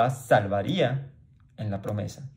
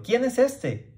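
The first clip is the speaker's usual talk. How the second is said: much higher, astonished question; stirred city asking; 12 semitones higher, slower than usual. Retold or imitated. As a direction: ask like a stirred, amazed crowd. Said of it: "toda la ciudad se conmovió diciendo". (pregunta asombrada y mucho más aguda)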